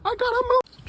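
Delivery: very high, slow squeak; squeaky high voice. Real, not acted. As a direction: squeal it out under attack. Getting attacked by bugs.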